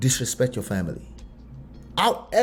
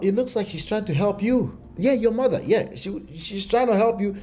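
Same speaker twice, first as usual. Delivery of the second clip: sarcastic; 7 semitones higher than usual. Real, not acted. Ironic about mother helping.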